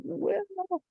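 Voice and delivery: high, soft little hesitation; hesitant hare. A narrator's reading. Hare asked to pick ox or rat, high and hesitant. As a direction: squeak it out, unsure.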